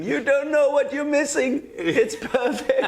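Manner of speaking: high, loud yell out of a window; gleeful yell. Retold or imitated. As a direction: shout it triumphantly, half laughing. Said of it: "opening a window and yelling".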